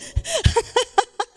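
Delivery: high-pitched burst of laughter; laughing. Real, not acted. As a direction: laugh at your own story. Ri da própria história. (gargalhada aguda)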